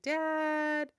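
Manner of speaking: high, loud cartoon call; calling out Dad. Mom imitating the Bluey opening, calling Dad high and loud. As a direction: belt out Dad like a kids' show opening. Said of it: "the next one's like".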